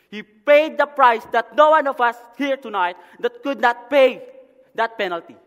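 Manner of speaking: high, loud, impassioned pulpit delivery; full-force preaching. Real, not acted. Preacher telling the congregation why Jesus came down, preaching at full force. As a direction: drive each phrase out loudly to the congregation.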